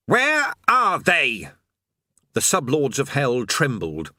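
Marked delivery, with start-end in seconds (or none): 0.1-1.5 slow, loud, looming demon lord; menacing demon lord. Acted.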